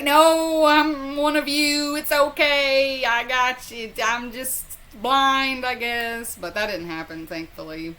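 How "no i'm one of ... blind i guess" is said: high, slow, sweet-talking plea; pleading sweet southern voice. Pretend. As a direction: talk your way out sweetly, higher. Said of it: "So I was gonna be like".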